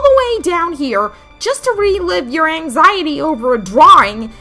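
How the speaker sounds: high, loud, exasperated outburst; angry disbelief. Acted. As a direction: lay into the one who got you trapped. Quarrel with calm friend while trapped.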